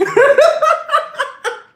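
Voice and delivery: very high breathless squeal of laughter. Real, not acted. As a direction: break up mid-bit. Breaks into laughter mid-bit.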